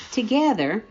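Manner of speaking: ordinary delivery, nothing marked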